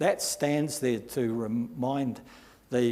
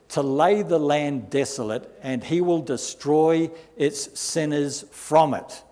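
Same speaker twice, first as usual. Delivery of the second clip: booming pulpit reading; preaching scripture; 4 dB louder than usual. Acted. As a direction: proclaim it like a prophet's warning. Reads prophecy from Isaiah.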